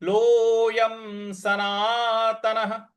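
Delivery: लोयम सनातनः, slow, with लो drawn out; slow recitation drill of लोयम सनातनः; long लो. Shown as a demonstration. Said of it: "ओ की मात्रा है उसको दीर्घ खींचना है".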